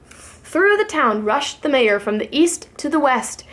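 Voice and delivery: ordinary delivery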